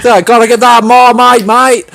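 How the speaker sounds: loud, high mock Aussie-British accent. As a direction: ham up the accent for a joke. Riffing on accent with Marmite joke.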